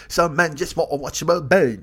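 a second bad Cockney attempt; Michael Caine. Comedian's second try at Michael Caine, bad Cockney accent. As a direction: mangle the famous line in Cockney. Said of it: "a second attempt at Michael Caine".

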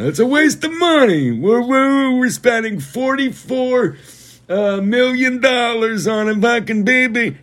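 very high mocking whine, slowing on the numbers; mock protester voice; whiny protester. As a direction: mimic a shrill complaining protester. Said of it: "people protesting the monarchy".

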